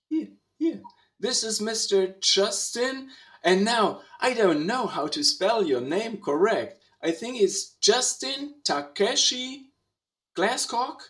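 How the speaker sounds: ordinary talk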